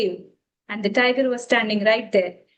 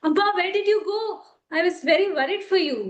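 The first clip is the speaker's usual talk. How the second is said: put-on higher, quick worried child voice; a small calf anxiously greeting mother; little calf; 8 semitones higher, faster than usual. Calf runs to its mother, higher worried child voice.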